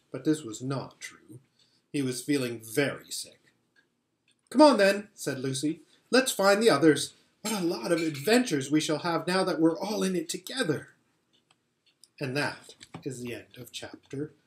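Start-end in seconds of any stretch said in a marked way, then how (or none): 4.5-10.9 higher, louder, keen; rally him to go; eager girl. A narrator's reading. Lucy urging Edmund to find the others, eager.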